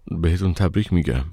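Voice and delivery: plain reading voice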